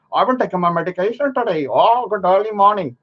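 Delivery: quick, mangled mock of a rambling man; a sneering impression of a rambling man; mocking imitation. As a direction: parody his mumbling at speed. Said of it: "and you go".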